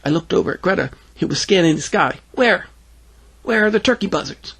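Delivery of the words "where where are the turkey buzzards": put-on high-pitched child's questions; curious little girl. Greta searches the sky, high curious kid voice. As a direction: ask eagerly in a high voice.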